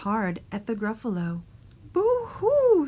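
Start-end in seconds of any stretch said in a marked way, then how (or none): 1.9-2.9 high, loud hooting cry; screeching owl. A narrator's reading. Owl sees the Gruffalo, high loud screech. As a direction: hoot it out high like a frightened bird. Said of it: "screeched Owl".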